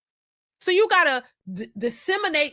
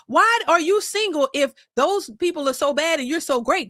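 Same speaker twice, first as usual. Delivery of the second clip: normal talking voice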